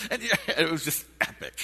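laughing through the words; chuckling while speaking. Real, not acted. Laughing recalling story.

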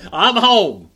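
laughing through it, loud and high. Real, not acted. Preacher laughing at his own Texas homecoming, laughing loudly. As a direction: let the laugh carry the words.

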